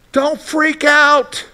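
drawn out high and slow; high, slow don't freak out. Shown as a demonstration. Pastor paraphrasing Jesus to his listeners, high and slow. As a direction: stretch it out, high. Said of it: "That's what he's saying here".

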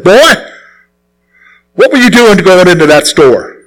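lightly voiced stern church elder; imitated deacon scolding. Old deacon to young man after prayer meeting, mock scolding. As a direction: raise it on the first word, then take him to task.

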